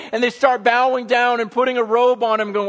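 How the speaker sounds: high, driving sermon delivery; impassioned preaching. Real, not acted. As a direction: paint the scene with full force. Full-force preaching.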